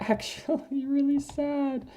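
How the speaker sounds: high, drawn-out sad whine; slow, plaintive little moan; really sad. Real, not acted. Player mourning the parrot she killed, really sad. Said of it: "I'm sad".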